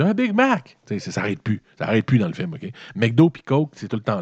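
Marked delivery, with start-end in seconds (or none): 0.0-0.8 put-on a much higher, eager kid voice ordering. Brother in the car answers what he wants, high eager kid voice. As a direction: chirp it like a kid begging for McDonald's. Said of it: "Il dit".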